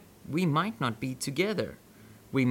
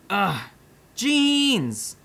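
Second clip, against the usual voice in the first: frustrated curse; 7 semitones higher, 4 dB louder than usual. Acted.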